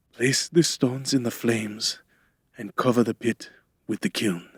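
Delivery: faint, labored instructions; slow, weakened voice. A narrator's reading. Weakened man instructing helper before fainting, faintly. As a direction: speak as if close to passing out. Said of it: "he said in a slow, lumbering voice".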